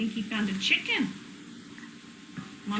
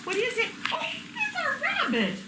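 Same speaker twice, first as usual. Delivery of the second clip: delighted surprise; 13 semitones higher than usual. Acted. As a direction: gasp it out like a big discovery. Surprised reveal to toddler.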